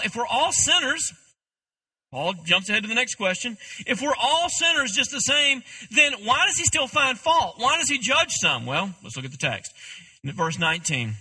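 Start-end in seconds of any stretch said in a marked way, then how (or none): 0.0-1.1 mild objection. Acted. Voicing the listeners' objection. 3.9-8.7 higher, louder voice of a protesting objector; challenging objection. Acted. Preacher voices his listeners' objection to judgment, challenging.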